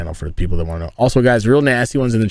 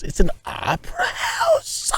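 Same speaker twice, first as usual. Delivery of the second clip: squeaky, much higher, slowed "It's an opera house?"; mock astonishment; 4 dB quieter, slower than usual. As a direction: feign shock in a cracking falsetto. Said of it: "mock surprise at opera house".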